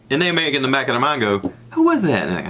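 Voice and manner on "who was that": high, mock-baffled question; puzzled. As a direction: wonder aloud in a raised pitch. Acts out puzzled thought after waving.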